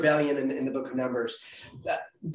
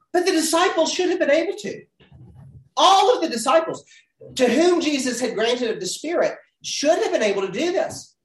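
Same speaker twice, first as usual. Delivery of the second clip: rising, louder insistence on ALL and HAVE; pressing the point that all should have; emphatic stress; 9 semitones higher, 4 dB louder than usual. Real, not acted. To the class, stressing the disciples' failure.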